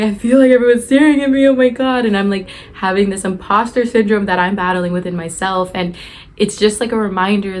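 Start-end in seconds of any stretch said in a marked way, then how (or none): 0.0-2.1 high, loud, rushed anxious gush; breathless excitement. Retold or imitated. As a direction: spill it out fast and breathless. Anxious rant after the performance.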